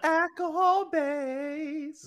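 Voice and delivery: mock ad pitch. Joking cologne pitch.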